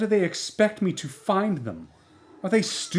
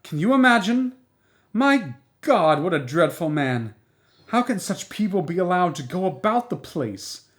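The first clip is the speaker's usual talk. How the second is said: high, loud indignant tirade; indignant, high-pitched outburst; furious outrage; 4 semitones higher, 3 dB louder than usual. Acted. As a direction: pour out your outrage to the neighbour. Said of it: "She was furious".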